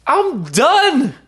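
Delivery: high, slow, pleading cry for help; desperate plea. Retold or imitated. A worn-out believer crying out to God, high and slow. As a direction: beg God at the breaking point, stretched out high. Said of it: "just be like".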